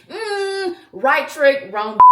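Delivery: high, far-off mocking "mm"; a sassy, mocking brush-off; sassy dismissal. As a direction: brush them off with attitude.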